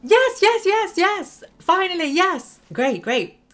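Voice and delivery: real high, loud burst of celebration; triumphant shouting. Real, not acted. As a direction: yell it out in triumph and relief. Long-awaited success in the game.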